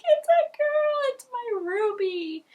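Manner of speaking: very high, slow, overjoyed. Retold or imitated. Mother learns she has a girl, very high and overjoyed. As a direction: savor each word with joy. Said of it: "I'm like".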